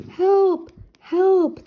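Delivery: high, loud repeated cries; cry for help. A narrator's reading. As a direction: shout for help, muffled. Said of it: "the voice cried from under the blanket".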